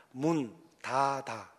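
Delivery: 닫아 said slowly, Gyeongsang way; Gyeongsang pronunciation. Shown as a demonstration. Preacher demoing his own accent: slow Gyeongsang 문 닫아. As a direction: drawl out 문 닫아 in dialect. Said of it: "우린 쌀 발음이 잘 안 되잖아요".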